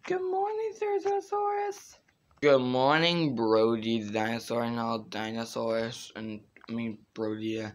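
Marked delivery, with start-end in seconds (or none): 0.1-2.0 put-on squeaky kid voice. High soft dinosaur voice.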